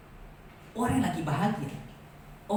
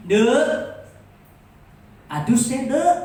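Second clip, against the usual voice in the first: put-on louder call to a child, not angry; 3 semitones higher, 8 dB louder, much slower than usual. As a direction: call the kid in for a bath, friendly. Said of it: "manggil itu nggak marah". (ibu memanggil anak untuk mandi, tidak marah)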